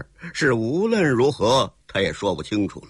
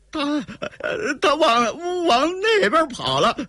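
high, stammering answer; trembling fear; 14 semitones higher than usual. Acted. Trembling villain points out the escape route, high frightened stammer. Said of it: "刘二拐子颤抖的把手往前边指了一指".

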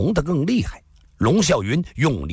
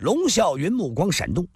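ordinary delivery

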